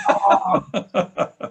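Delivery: high squeaky startled oh; a sudden realizing gasp of oh; mock surprise. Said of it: "I'm like".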